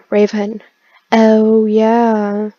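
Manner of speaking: ordinary talk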